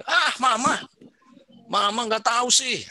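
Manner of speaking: put-on high, loud sulky child; whiny teenager. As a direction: whine back at mom like a teen. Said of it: "kalau saya jadi anak, saya bilang gini". (anak remaja merengek ke Mama, tinggi dan keras)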